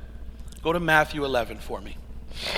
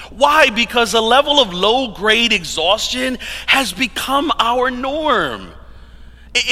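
emphatic, raised preaching voice; 7 semitones higher, 3 dB louder than usual. Acted. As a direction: drive the point home to the crowd.

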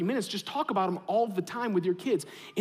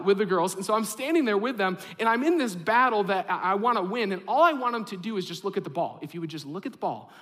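ordinary talk

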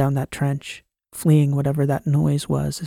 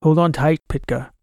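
through a speaker; 3 dB louder than usual. Acted. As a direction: voice over ship's intercom. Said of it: "voice spoke through the shuttle's control system".

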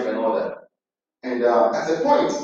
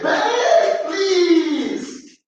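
desperate pleading cry. Acted. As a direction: cry out please as if begging for his life. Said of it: "Joseph pleaded".

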